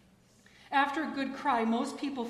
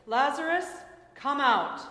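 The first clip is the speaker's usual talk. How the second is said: raised, commanding call, well above reading level; loud cry; 4 semitones higher, 8 dB louder than usual. A narrator's reading. Jesus calls the dead man from the tomb, loud cry. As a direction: call out loud and high to summon someone. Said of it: "he cried with a loud voice".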